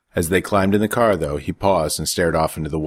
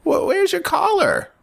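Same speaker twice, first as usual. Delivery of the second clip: much higher, halting, stammered; startled question; slower than usual. A narrator's reading. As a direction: catch him out, surprised. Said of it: "the older woman said".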